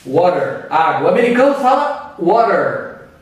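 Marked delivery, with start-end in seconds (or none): 0.1-0.7 American "Water", R sounded at the end; American flapped T. Shown as a demonstration. Teacher giving an example word, American flapped T in "Water". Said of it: "O T fica meio R e meio D". 2.2-3.1 "water" with T halfway between R and D; American flapped T. Shown as a demonstration. Teacher showing how Americans speak, flapped T in "water". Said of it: "O T fica meio R e meio D".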